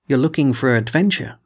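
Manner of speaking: ordinary delivery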